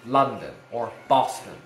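LONDON and BOSTON punched on their first syllables; first syllables of London and Boston stressed hard. Shown as a demonstration. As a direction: hit LON in London and BOS in Boston. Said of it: "Syllable stress goes on the first syllable".

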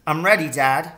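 put-on a young son, keen and ready; boy's voice. A narrator's reading.

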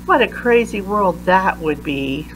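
usual speaking voice

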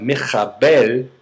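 "michabel" with a throaty kh in the middle; guttural Hebrew sound. Shown as a demonstration. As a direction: stretch "michabel" slowly, kh from the throat. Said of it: "which includes a guttural".

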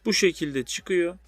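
normal talking voice

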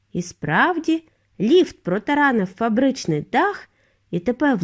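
plain reading voice

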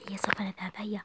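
hushed urging; whisper. Acted. Sister urging her sister to leave, whispering. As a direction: whisper let's go. Sneaking away.

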